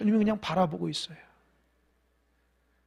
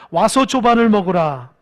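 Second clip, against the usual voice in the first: louder, leading "조반을" with a falling stress; call to repeat; 9 dB louder than usual. Shown as a demonstration. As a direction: proclaim it so they echo it back. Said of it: "따라해보세요". (따라하도록 크게 "조반을"을 힘주어)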